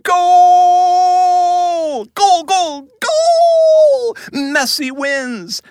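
screaming goal call, very high and loud; frantic mock sports commentary. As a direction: bellow it like a soccer announcer.

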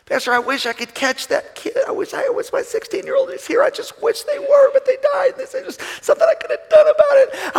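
high-pitched, strained plea of a grieving father; a hint of a parent choking on grief. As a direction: voice a heartbroken parent wishing. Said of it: "people tell me all this".

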